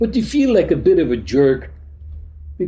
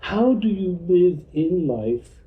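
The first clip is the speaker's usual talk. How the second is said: usual speaking voice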